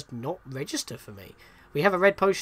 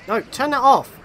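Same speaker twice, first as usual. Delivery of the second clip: louder, exasperated repeat; frustrated shout; 8 semitones higher, 9 dB louder than usual. Real, not acted. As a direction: yell it at the screen, more annoyed. Frustrated at game controls.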